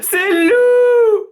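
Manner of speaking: cri très aigu et fort, traînant; hurlement de fillette. A narrator's reading. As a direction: hurler de terreur. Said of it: "hurle Violette". (Violette hurle, cri très aigu)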